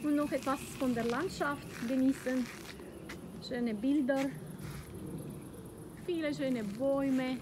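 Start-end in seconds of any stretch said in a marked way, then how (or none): none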